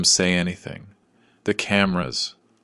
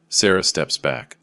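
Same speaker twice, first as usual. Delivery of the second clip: plain reading voice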